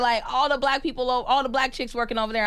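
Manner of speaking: high, loud mock-outraged protest; indignant outburst. Stuntwoman retelling being left off the movie, high-pitched indignation. Acts out past complaint at exclusion.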